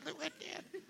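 mock pain. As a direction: ham up an aching joint with an ow. Mock pain at his joints.

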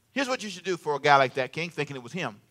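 ordinary talk, nothing marked

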